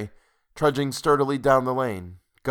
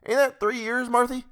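much higher-pitched rustic voice; old landlord asking his wife; 13 semitones higher than usual. A narrator's reading. Landlord checking with his sewing wife, high and folksy. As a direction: call the question over in a high country voice.

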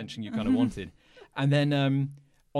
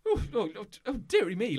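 a touch higher, prim dearie-me lady; lightly voiced fussy lady; 8 semitones higher, faster than usual. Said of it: "this lady in red is going like".

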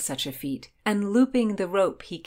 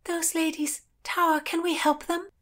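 high, plaintive wail at usual volume; 11 semitones higher than usual. A narrator's reading. Heroine wails to the boatman about the ladies, high wailing. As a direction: wail the plea in a raised pitch. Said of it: "and wailed".

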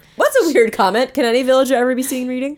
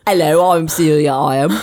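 low, hammed-up British accent; bad British accent; 7 semitones lower, 4 dB louder than usual. Celia introducing herself in a low, terrible British accent. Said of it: "some terrible accents".

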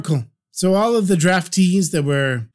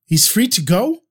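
ordinary delivery

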